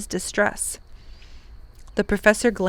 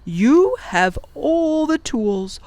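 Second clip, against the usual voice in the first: firm professor; 4 semitones higher than usual. Acted. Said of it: "said firmly".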